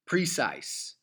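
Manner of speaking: precise with the stress on the first syllable; PRE-cise, emphasis moved to the front; wrong stress on PRE. Shown as a demonstration. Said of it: "the opposite ways of the ways".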